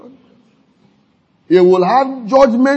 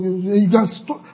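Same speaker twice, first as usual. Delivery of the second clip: normal talking voice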